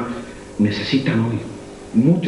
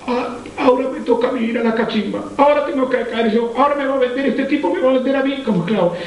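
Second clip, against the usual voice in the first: un toque de hermano temeroso, rápido al final; hermanos asustados; 11 semitones higher than usual. Acted. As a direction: imita a medias al hermano que teme ser vendido. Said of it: "se acercaron como diciendo". (hermanos de José temiendo ser vendidos, imitados a medias)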